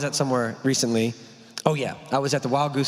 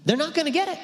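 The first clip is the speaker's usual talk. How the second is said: voicing Jesus; 14 semitones higher, faster than usual. Jesus's reply voiced.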